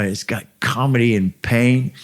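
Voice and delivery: put-on a quick stab at an Irish accent. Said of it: "but his Irish accent".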